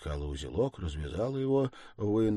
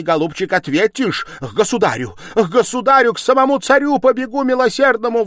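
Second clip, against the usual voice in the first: high, screaming, relentless fury; frenzied tirade; 18 dB louder than usual. A narrator's reading. Stepmother defending Sonya and daring a search, frenzied shouting. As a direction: rant hysterically and dare them, at full voice.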